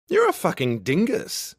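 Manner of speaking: high, loud exasperated friend voice; Fitz. A narrator's reading. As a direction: scold a friend, pitched well up. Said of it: "Fitz says, hitting me over the head".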